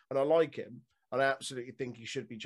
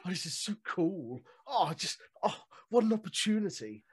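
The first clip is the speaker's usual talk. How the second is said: higher, giddy mock of an eager rookie; gushing newbie; 6 semitones higher than usual. Co-host mocking Nathan Fraser's eager gimmick, high and giddy. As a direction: gush like a starstruck rookie.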